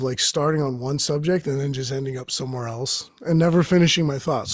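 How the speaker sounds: ordinary talk, nothing marked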